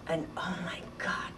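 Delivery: hushed whisper of shock; awed whisper. Retold or imitated. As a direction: breathe it out in remembered dread. Recalling bombs lying in the dark.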